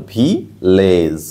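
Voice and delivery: ভিলেজ slowly split as ভি, লেজ with a long ay; sounded-out pronunciation. Shown as a demonstration. Teacher showing the letter A's sound, ভিলেজ slow and in two parts. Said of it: "এর উচ্চারণ কেমন হবে".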